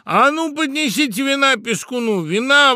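very high, loud toastmaster's call; exclaimed command. A narrator's reading. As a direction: order wine across a noisy feast. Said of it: "воскликнул Тамада".

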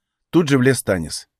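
ordinary delivery

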